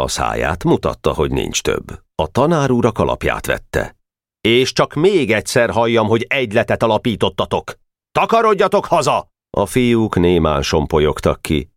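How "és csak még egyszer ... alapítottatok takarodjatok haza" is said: higher, building to a sharp "Takarodjatok haza!"; angry teacher. Acted. Teacher chasing the boys out, cross. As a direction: send them home sternly.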